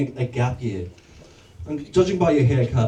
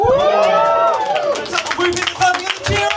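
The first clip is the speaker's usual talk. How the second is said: high, loud, drawn-out crowd call; hype announcer; 14 semitones higher, 4 dB louder, slower than usual. Real, not acted. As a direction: whip up the crowd to welcome the headliner. Whipping up crowd for headline act.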